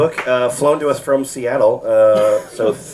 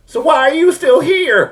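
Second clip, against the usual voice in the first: put-on high girlish voice; 4 dB louder than usual.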